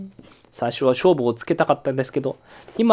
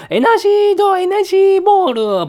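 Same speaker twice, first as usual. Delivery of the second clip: attack call; 7 dB louder than usual. Pretend. Shouting Pokémon attack name.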